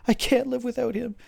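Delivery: high, loud desperate wail; distraught screaming. Acted. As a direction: cry it out, loud and frantic. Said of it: "she starts screaming".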